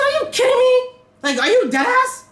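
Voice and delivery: very high, indignant questioning; retold squeal of outrage; incredulous outburst. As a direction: challenge them in high-pitched disbelief. Retold incredulous challenge to police.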